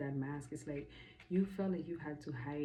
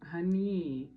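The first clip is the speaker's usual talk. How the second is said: slow, drawn-out, louder sassy "honey"; 8 dB louder than usual. Real, not acted. Vlogger calling the viewer honey, slow and sassy. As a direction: stretch it out with attitude.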